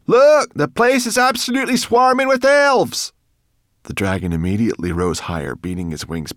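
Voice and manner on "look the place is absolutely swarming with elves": high, loud frantic exclamation; shrieking exasperated alarm; alarmed cry. A narrator's reading. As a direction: shout the warning in a panic. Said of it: "cried Sorrel".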